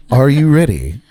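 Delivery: lightly voiced formal interview host; a touch of theatrical interviewer; James Lipton impersonation. Host checks his guest is ready, lightly impersonated interviewer. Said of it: "It's my best James Lipton impersonation".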